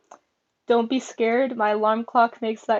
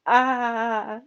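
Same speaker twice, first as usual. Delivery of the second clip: ordinary talk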